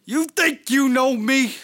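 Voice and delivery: shouted high wrestler challenge; wrestling promo. Acted. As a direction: bellow it at your rival. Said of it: "cut a promo".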